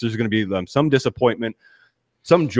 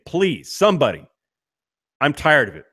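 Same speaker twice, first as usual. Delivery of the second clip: ordinary talk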